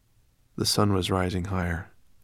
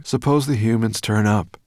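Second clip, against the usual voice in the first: raised, worried calling voice; anxious call; 4 semitones higher, 6 dB louder than usual. A narrator's reading. Dragon fearing humans may find Sorrel, calling anxiously. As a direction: call out a nagging worry. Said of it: "he called anxiously".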